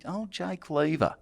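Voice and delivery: usual speaking voice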